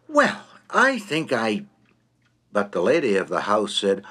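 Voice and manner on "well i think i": slow, halting attempt to take leave; a hint of a nervous guest forcing out goodbye; bracing for the effort. A narrator's reading. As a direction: screw up courage, then stall mid-phrase. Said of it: "braced himself for the effort and said suddenly".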